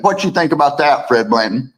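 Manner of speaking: ordinary talk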